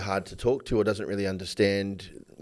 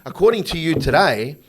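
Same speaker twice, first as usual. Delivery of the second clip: loud, higher, incredulous protest; exasperated retort; 5 semitones higher, 5 dB louder than usual. Retold or imitated. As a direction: argue back in disbelief. Man retorting to his partner.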